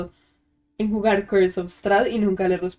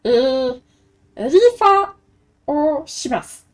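goblin. Acted. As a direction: play a giddy little goblin doing the raffle. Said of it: "está hablando en Goblin". (voz de goblin chillona)

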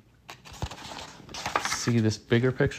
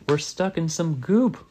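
raised pitch, contained yell; mild mock yell; 7 semitones higher than usual. A narrator's reading. Ralphie stuck in the nectar, mild yell. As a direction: call out in alarm without real volume. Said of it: "Ralphie yelled".

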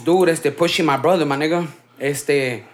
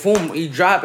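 ordinary talk, nothing marked

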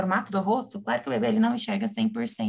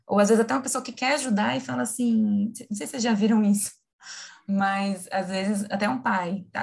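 usual speaking voice

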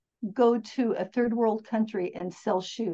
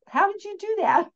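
mom amazed; 8 semitones higher than usual. Retold or imitated. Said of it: "She goes".

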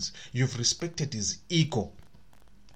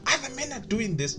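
nagging wife; 10 semitones higher than usual. Retold or imitated. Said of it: "Do not say to him".

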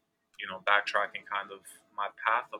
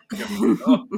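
loud, high, laughing denial; 12 semitones higher, 13 dB louder than usual. Real, not acted. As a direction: laugh it off with a firm no. Laughs denying he wanted it.

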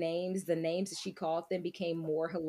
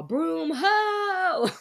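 high, loud witchy call; mock witch cry; 9 semitones higher, 7 dB louder than usual. Friend voicing the witches calling out, high and loud. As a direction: squeal it like a silly witch. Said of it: "they're all".

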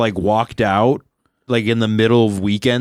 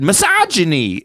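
high-pitched imitated kid outburst; imitating a kid, pitched high; high squeaky kid; 7 semitones higher than usual. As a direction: blurt the word in a squeaky kid voice. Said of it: "like this high squeaky voice".

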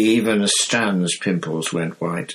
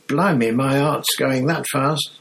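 higher, rattled conductor catching his breath; higher, nervous Stan; shaken Stan; 6 semitones higher than usual. Acted. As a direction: still shaken by the name. Stan shaken, still voiced.

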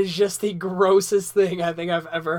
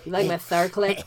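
lisping mock nerd voice. Playing a Star Trek fan for laughs, lisping nerd. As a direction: lisp it like a cartoon nerd. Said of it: "can't even talk like this shit".

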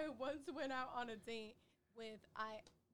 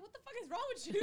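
1 laughing retold outrage; 10 semitones higher, 7 dB louder, much faster than usual. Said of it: "I was like".

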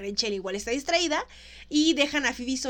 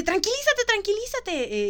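higher, slowing urgent calming; excited Rachel; 8 semitones higher than usual. Retold or imitated. Rachel excitedly calming Phoebe, imitated. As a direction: hype but hushing her friend. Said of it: "Rachel súper emocionada le dice".

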